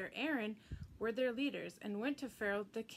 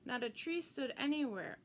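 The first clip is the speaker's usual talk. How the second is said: usual speaking voice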